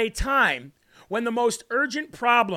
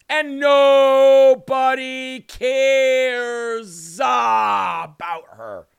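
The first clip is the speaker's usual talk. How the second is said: drawn-out mock shout; 4 dB louder, much slower than usual. Mocking [name], stretched words.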